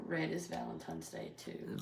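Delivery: throat hurts, barely any voice; raspy whisper. Real, not acted. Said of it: "I have no voice".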